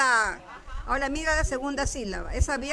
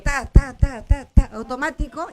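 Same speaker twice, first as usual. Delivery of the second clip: loud quick ta ta ta, like a habit running itself; punchy beat of automatic syllables; rhythmic tapping sound; 6 dB louder than usual. Shown as a demonstration. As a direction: tap it out fast and loud. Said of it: "Y luego es automático".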